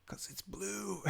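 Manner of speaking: put-on soft, high toddler voice; little kid voice. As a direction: imitate a small child's sweet answer. Voices toddler's cute answer.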